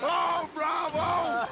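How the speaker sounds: high, drawn-out bravo; delighted cheer. Real, not acted. Applauding friends after their song, delighted cheer. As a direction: cheer the singers on.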